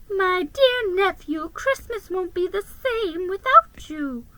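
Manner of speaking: a hint of a wistful old aunt; sighing aunt. A narrator's reading. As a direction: sigh it out fondly. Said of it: "she said, sighing".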